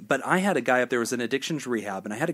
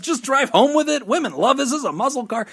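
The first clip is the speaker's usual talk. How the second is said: much higher and louder, a hammed-up gushing woman; gushing saleswoman; 11 semitones higher, 5 dB louder than usual. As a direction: sell it with over-the-top delight. Give us a female perspective.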